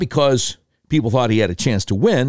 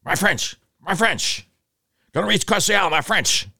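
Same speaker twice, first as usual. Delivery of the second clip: McCain impression; 8 semitones higher than usual. McCain debating Obama, high and fussy. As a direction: repeat the phrase like a flustered old senator. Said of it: "against McCain".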